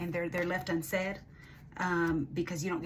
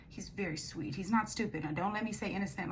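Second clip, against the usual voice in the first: ordinary talk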